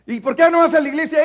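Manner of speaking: loud insistent question. Acted. Pastor hammering an ex-member with the same question, loud. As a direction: keep pressing him for a reason.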